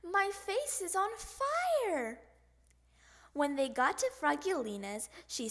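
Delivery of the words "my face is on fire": high, slow, drawn-out wail; alarmed yell. A narrator's reading. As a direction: wail it as if stung. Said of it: "he yelled".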